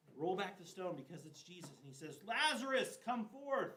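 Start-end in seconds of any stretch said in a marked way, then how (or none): 2.3-3.7 commanding call. Acted. Jesus calling Lazarus out of the grave, loud command.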